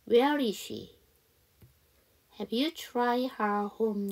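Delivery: very slow, measured English dialogue; slow practice reading. Shown as a demonstration. Teacher reads dialogue for students to repeat, slow modelling. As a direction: read each line slowly with gaps for repeating. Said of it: "여기 저랑 같이 한번 연습해봐요".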